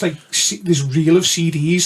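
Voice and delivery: ordinary talk, nothing marked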